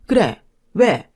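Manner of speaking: loud, curt snap back; a sharp shout flung across the doorway; angry retort. Acted. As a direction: bark it at her like a challenge. Sister-in-law yells at the door.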